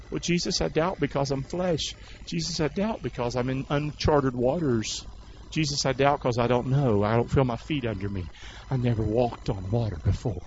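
soft, subdued confession of doubt; meek excuse. Acted. Doubting Peter explains himself to Jesus, meek soft excuse. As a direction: answer quietly, almost apologetically.

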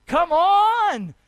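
high, loud preacher's exhortation; rousing shout. Real, not acted. Preacher rousing the congregation over blessed America, high loud call. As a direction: urge the crowd to respond.

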